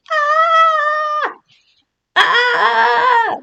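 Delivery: high, loud mock scream for the pet; pretend scream. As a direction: shriek on the turtle's behalf. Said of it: "I'm screaming for you".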